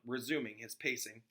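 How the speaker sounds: ordinary delivery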